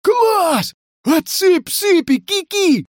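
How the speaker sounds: high, gleeful squeal of a cry; thrilled, high-pitched character voice; delighted. Acted. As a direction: cheer it out in a very high voice. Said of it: "обрадовался Горыша".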